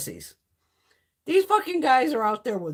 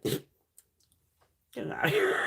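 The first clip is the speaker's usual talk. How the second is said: whispered. Retold or imitated. Quoting her own snake reaction.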